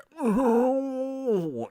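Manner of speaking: high wailing laugh; shrieking cry-laugh; ridiculous noise. Real, not acted. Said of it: "making that fucking ridiculous noise".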